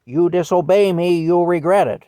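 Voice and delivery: retold angry warning, shouted high; mock threatening shout. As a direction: bark the threat like a furious elder. Threat quoted in retold story.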